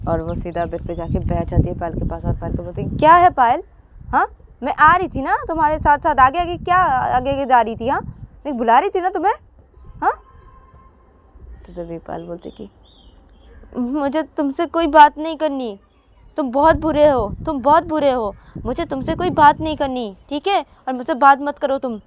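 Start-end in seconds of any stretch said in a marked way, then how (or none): 3.0-10.1 high, loud demanding questions; annoyed confrontation. Acted. Ananya confronts Payal for walking ahead, loud annoyed questioning. 13.8-22.0 put-on high, loud childish refusal; angry sulk. Payal refuses to talk to Ananya, loud hurt sulk.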